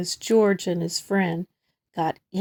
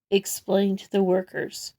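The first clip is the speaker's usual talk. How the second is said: usual speaking voice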